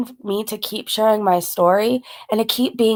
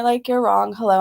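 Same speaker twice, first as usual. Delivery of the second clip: normal talking voice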